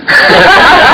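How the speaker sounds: real high-pitched laugh at the story; bursting, high cackle of amusement; high laughter. Real, not acted.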